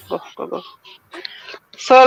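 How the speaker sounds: usual speaking voice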